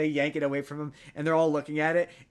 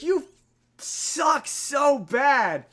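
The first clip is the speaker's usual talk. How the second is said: much higher, louder and slow; mock furious yell; 12 semitones higher, 7 dB louder, much slower than usual. Man cursing the priest who tricked him, furious. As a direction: curse him out in hammed-up rage.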